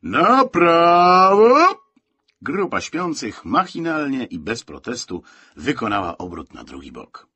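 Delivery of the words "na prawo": very loud, high, drawn-out military order; orderly's long shouted command; sudden barked command. A narrator's reading. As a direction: bellow the order, stretching the last word. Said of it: "nagłą komendą dyżurnego".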